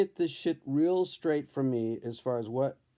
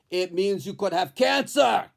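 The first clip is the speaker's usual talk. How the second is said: louder, ominous doctor, pitched higher; Grim Reaper doctor; 5 semitones higher, 6 dB louder than usual. Retold or imitated. Doctor tells the patient he could have cancer, louder and ominous. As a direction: loom over him with the news. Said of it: "looks like the Grim Reaper".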